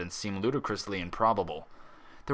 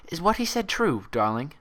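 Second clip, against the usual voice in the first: gentle head nurse; 8 semitones higher than usual. A narrator's reading. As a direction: coax a child-like patient gently. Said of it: "the gentle look on the nurse's face".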